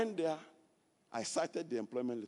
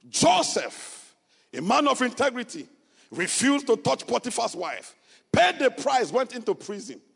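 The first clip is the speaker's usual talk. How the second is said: loud, high preaching cry; full-force preaching; 12 semitones higher, 13 dB louder than usual. Acted. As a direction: preach it to the rafters.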